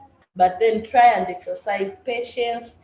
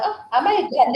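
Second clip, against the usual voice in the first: higher, louder sarcastic comeback; mocking retort; 7 semitones higher, 5 dB louder than usual. Angry customer mocking the staff's question, higher and louder. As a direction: throw the question back at them. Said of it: "Then I'm like".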